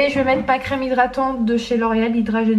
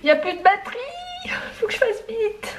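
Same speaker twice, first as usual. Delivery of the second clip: high-pitched alarm; 10 semitones higher, 3 dB quieter than usual. Real, not acted. Vlogger warns viewers battery is dying, high alarmed voice. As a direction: squeal it out, much higher than usual.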